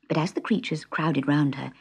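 ordinary delivery, nothing marked

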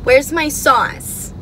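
usual speaking voice

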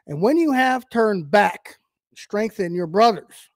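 slow, lifted reading of the verse; preacher reading from Luke; scripture reading. Acted. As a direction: let the verse ring out as it is read. Reads scripture aloud.

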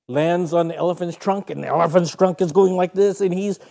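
usual speaking voice

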